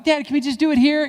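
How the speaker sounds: put-on high, loud whiny child asking; a kid begging dad to skip the rug, high and pleading. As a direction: beg like a kid who wants to skip it.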